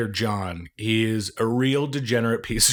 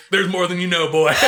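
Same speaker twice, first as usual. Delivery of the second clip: laughing; 7 semitones higher, 5 dB louder than usual. Retold or imitated. Quoting dad's reply, laughing.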